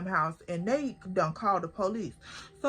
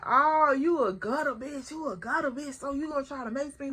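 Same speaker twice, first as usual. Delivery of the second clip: scornful call-out; 3 semitones higher than usual. Retold or imitated. Calling the girl out for the mace, scornful and quick. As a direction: sneer at her for pulling mace. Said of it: "I'm like".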